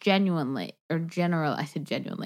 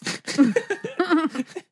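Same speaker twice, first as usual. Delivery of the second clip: high-pitched burst of laughter; 11 semitones higher, 3 dB louder than usual. Real, not acted. Laughs at her own rating.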